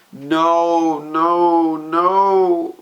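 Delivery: pleading against the page; anguished no no no. Real, not acted. Fan begging Hanji not to go, high pained nos.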